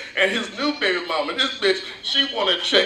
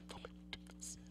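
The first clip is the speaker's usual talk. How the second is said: mock scared whisper; 31 dB quieter, faster than usual. Scared woman begging the robber.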